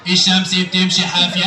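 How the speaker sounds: very low puppet character voice; Aragoz puppet. Acted. Puppet telling the host his school subjects, Aragoz voice. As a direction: list it as a puppet, far below your own pitch. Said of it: "الأراجوز ده وهو بيرغي".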